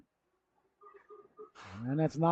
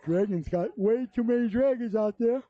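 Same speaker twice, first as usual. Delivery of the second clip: yawning; 5 semitones higher than usual. Real, not acted. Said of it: "I'm not yawning 'cause I'm bored".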